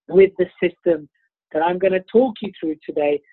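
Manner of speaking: ordinary delivery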